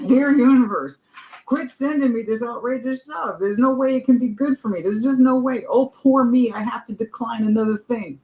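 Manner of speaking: high mock plea to the universe; mock complaint. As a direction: whine to the universe, hammed up. Mock prayer of self-pity.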